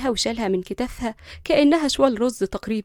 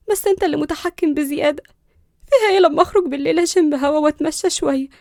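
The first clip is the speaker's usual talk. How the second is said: put-on higher young woman's voice, pleading her case; a higher, louder woman defending herself; Linda, hurt and defiant; 5 semitones higher, 5 dB louder than usual. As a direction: argue back, wounded but stubborn.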